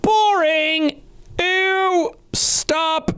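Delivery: put-on high, jeering heckler shouts at his old self; mock heckling. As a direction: boo your own old video like a bratty heckler. Mocking his old video.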